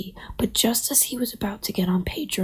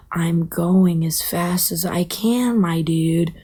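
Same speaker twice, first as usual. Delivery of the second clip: slow, unhurried turtle drawl; laid-back, plodding turtle voice; 3 semitones lower than usual. Acted. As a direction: take your time, dragging the words out. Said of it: "Pedro moves like this".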